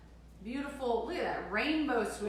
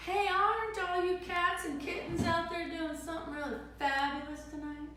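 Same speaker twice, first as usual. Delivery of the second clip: higher, sing-song cats-and-kittens greeting; hip radio host; 8 semitones higher than usual. Acted. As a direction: croon it like a retro late-night DJ. Greeting viewers in showy sing-song.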